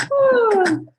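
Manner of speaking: high loud joyful whoop; cheering whoop. Real, not acted. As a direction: celebrate the finish with a big whoop. Cheering again.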